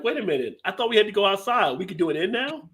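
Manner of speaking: high, lightly voiced bewildered dog; puzzled dog. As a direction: play a dog baffled by new house rules. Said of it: "The dog is like".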